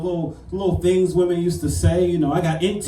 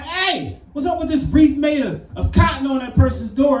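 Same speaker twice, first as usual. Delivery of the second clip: high indignant voice; 8 semitones higher than usual. Retold or imitated. Quoting complaint to landlord.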